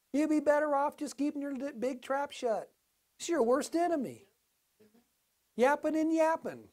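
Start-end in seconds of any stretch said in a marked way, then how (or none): none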